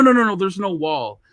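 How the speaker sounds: ordinary delivery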